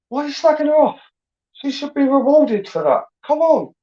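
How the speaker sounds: high-pitched, exasperated protest; exasperated telling-off; indignant challenge. Real, not acted. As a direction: take them to task, pitch jumping right up. Challenges men who slag women off.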